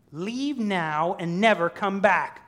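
loud, high, slow command; defiant banishing. Acted. Preacher as Smeagol first banishing Gollum, defiant. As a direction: order the other away firmly.

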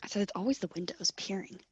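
"always" whispered softly; whispering. Real, not acted. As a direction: whisper the repeat.

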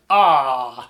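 lightly imitated gasp of admiration; gushing co-commentator. Imitating Joey on co-commentary, mildly awestruck. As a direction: mimic a pundit sighing in wonder. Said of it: "All I heard was".

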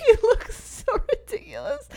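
high-pitched words through giggles; laughing delight. Real, not acted. Co-host describing adults playing teens, high laughing voice. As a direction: giggle while saying it.

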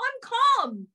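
high-pitched retold insistence; upset protest. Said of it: "when I was crying".

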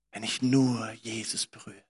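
hushed inner whisper, breathless hope. Acted. Woman whispering her secret hope. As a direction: whisper it to yourself.

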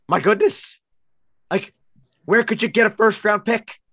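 high, loud exasperated rant; angry rant. Real, not acted. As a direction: vent at the team, furious. Ranting about a bad trade.

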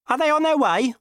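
high, loud fretful voice; anxious Dickie. Acted. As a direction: pester her for news. Dickie anxious, covered in oil.

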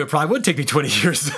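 laughing through the words. Real, not acted. Admitting the truth to the host, laughing. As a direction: chuckle while admitting it.

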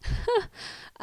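high breathy scoffing laugh; mocking laugh. Lifeguard laughing at the mother, mocking. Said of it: "أجاب ساخرا".

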